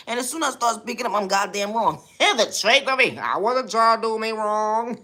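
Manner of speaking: hammed-up high-pitched trash talk; sassy persona voice. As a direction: taunt the commenters in a high cartoon diva voice. Venting in put-on persona voice.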